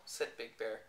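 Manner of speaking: ordinary delivery, nothing marked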